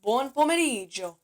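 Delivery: Buon pomeriggio with an Italian lilt; Italian pronunciation. Shown as a demonstration. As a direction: model Buon pomeriggio for learners. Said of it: "I learned this too".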